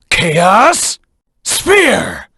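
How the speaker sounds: high shout of the attack name; battle cry. Acted. Hedgehog hero calling out his special attack, high shout. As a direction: call the move out sharply.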